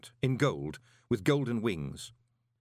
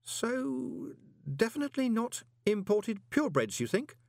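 distant, dreamy, higher voice; musing as if far away; faraway voice; 12 semitones higher than usual. A narrator's reading. Moist musing to Groat, in a faraway voice. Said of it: "in a faraway voice".

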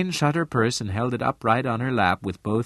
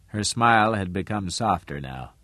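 ordinary delivery, nothing marked

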